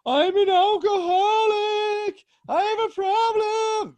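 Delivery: very high, slow, hammed-up drunk teen sob; fake-cry it to get out of trouble; mock wailing. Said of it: "wailing in my room being like".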